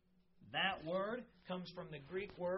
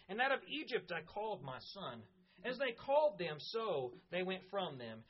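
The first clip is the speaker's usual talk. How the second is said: scripture reading; 2 semitones higher than usual. Acted. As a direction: read the passage with weight. Reads Hosea chapter eleven aloud.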